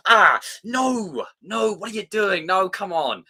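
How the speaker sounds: loud, high cry of dismay; acted-out exasperated "no"; cringing protest. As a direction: plead at the screen to stop. Acts out reaction to cringe.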